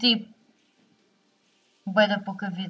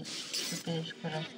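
cursing under breath; 7 semitones lower, 15 dB quieter than usual. Real, not acted. Muttering after mistake.

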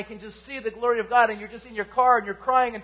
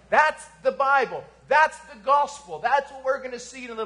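full-force preaching; 2 semitones higher, 6 dB louder than usual. Acted. Pastor exhorts the congregation about their greatest need, preaching hard. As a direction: preach it hard to the back row.

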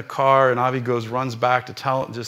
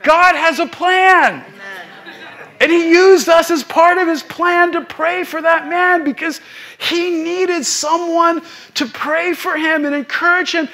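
climbing higher and louder into full preaching cry; preaching at full force; 13 semitones higher, 6 dB louder than usual. Acted. Preacher retelling his son's words to the congregation, building to a full-force preaching cry. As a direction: lift the congregation, louder and higher with each line, sometimes through a laugh.

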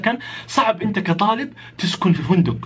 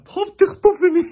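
high, louder panicky squeal; exaggerated fear; 12 semitones higher, 5 dB louder, slower than usual. Traveller confessing he feared the driver would kidnap him, mock-terrified. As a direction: squeal it in comic terror. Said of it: "من كتر الخوف".

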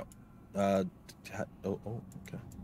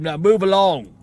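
loud, raised put-on guard voice; gruff guard; 6 semitones higher, 11 dB louder, slower than usual. Jail guard herding a cleric along, loud command. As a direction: order the prisoner onward forcefully.

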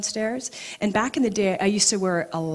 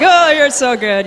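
much higher and louder gushing oh; excited praise; 7 semitones higher, 11 dB louder than usual. Retold or imitated. Gushing at psychic friend on the phone. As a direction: gush over her friend's powers. Anna already knows on phone.

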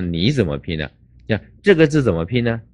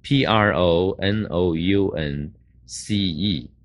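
slow letter by letter spelling; slow teacher spelling of pronounce; much slower than usual. Shown as a demonstration. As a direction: spell each letter slowly and clearly.

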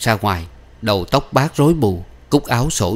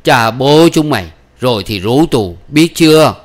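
raised, winded cursing; winded, angry old man's threat; panting old man; 4 semitones higher, 8 dB louder than usual. Acted. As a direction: curse them between gasps. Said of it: "Lão thủng hển".

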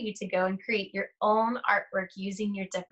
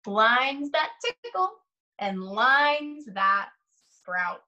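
put-on slow, sing-song read-aloud to young children; lingering on each rhyme, long pauses between pages; story read aloud; 3 semitones higher, much slower than usual. Reads picture book aloud slowly.

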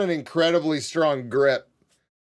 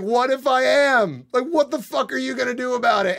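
retold defiant comeback; 7 semitones higher than usual. Storyteller replaying his comeback to an insulting guy, mock defiance. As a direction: act out squaring up to someone, pitched high.

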